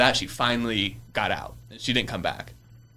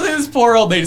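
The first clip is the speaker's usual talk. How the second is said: much higher, louder, hammed-up sympathy; mock pity; 12 semitones higher, 8 dB louder than usual. Pitying the old lady he wrongly accused, mock sympathetic. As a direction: coo it in an exaggerated sorry voice.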